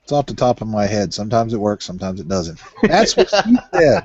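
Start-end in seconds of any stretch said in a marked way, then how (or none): 2.8-4.0 laughing joke. Real, not acted. Laughing punchline.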